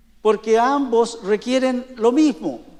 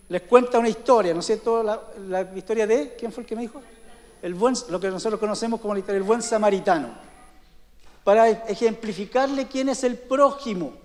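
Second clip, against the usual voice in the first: a louder preacher's voice, driving each point home; forceful preaching; 2 semitones higher, 4 dB louder than usual. Acted. Pastor driving the point home to his congregation, forceful preaching.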